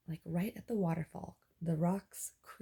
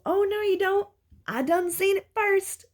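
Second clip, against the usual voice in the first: quoted movie line; 11 dB louder than usual. Retold or imitated. Dreamer beating the steampunk man to treasure, loud movie-quote yell. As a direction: shout the movie line, staking your claim. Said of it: "That's a line from one of my favorite movies".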